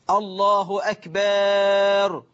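أكبر with a long held "akbaaar"; added alif in أكبر. Shown as a demonstration. Teacher shows a worshipper's mistake, أكبر stretched to "akbaaar". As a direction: draw out the vowel before the final r of أكبر, very slowly. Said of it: "إدخال ألف بعد الباء وقبل الراء".

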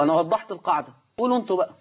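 ordinary talk, nothing marked

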